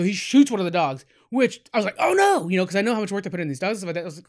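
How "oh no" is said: high, loud gasp of dismay; shocked oh no. Retold or imitated. Fan watching the dog get shot, high shocked cry. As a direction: yelp it out as the dog gets shot.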